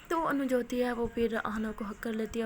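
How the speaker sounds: ordinary delivery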